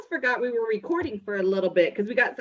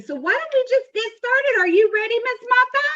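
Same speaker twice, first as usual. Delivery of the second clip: excited; 9 semitones higher than usual. Acted. Hosting assembly, high energy.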